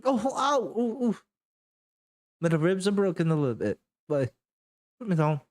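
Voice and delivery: high pained yelps, then fast plea; squeezed in a hug. Acted. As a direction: wince and ask to be put down. Rosie squeezed, ribs broken.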